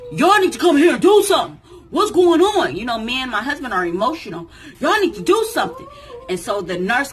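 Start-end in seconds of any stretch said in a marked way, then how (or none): 0.1-2.8 loud, high, rapid shout; urgent demand. Retold or imitated. Uncle demands the nurses do something, urgent shouting. 4.8-5.9 loud, high, rapid shout; urgent demand. Retold or imitated. Uncle demands the nurses do something, urgent shouting.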